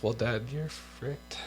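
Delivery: ordinary talk, nothing marked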